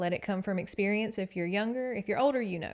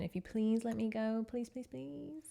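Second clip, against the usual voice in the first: pleading; 3 semitones higher, 5 dB quieter than usual. Retold or imitated. Daughter begging to go on voyage.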